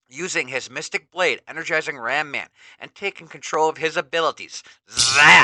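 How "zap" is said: sudden loud zap; zap effect. A narrator's reading. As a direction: blast the sound effect. Narrator's sound effect.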